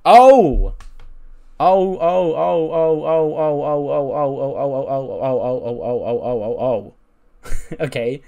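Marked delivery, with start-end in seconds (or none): 0.0-6.9 a long run of ohs, stepping down from a shout; amazed whooping "oh". Real, not acted. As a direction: burst out with a shout, then keep the ohs rolling downward. Reacting to a huge match rating.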